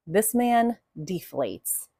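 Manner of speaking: normal talking voice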